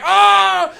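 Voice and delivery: high, loud tasered yelp; hammed-up shriek as the taser hits; mock scream of pain. Stranger electrocuted by tasers.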